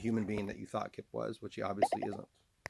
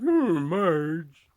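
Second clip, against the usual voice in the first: loud, high drawn-out cartoon groan; put-on cartoon voice; 7 semitones higher, 13 dB louder than usual. Shown as a demonstration. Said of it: "then goes into this voice".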